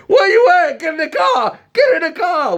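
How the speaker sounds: high-pitched mock shouting; urgent squealed command; frantic hollering. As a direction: imitate the cousin yelling on the run. Cousin bursts out yelling for him.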